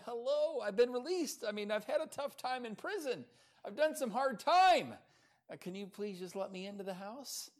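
high-pitched mock plea from outside; plaintive pleading. Poor Peter pleading, locked outside.

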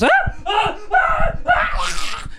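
high strained death cries; dying scream. Acted. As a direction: wail like a doomed henchman. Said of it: "the guy who goes".

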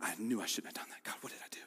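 breathy whisper of regret; whispered self-reproach. Retold or imitated. Drenched Peter, eyes closed, whispering regret to himself. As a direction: whisper it to yourself in shame.

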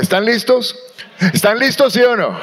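ordinary talk

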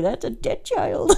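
slow remark through laughter; amused, laughing delivery; imitated dad. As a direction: quote it while cracking up. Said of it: "my dad from the kitchen was like".

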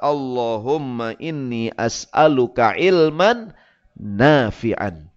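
slow, drawn-out supplication; prayer recitation. Acted. Preacher reciting the Prophet's dawn prayer with translation, slow supplication. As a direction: recite each phrase slowly and deliberately.

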